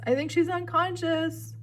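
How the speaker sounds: high, loud shouted report; yelled from above. A narrator's reading. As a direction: shout hopeful news down to friends. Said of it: "Grover yelled somewhere above us".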